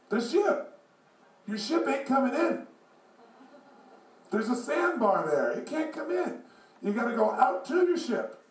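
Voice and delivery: put-on high-pitched old man calling out. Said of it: "this old guy, probably seventy years old".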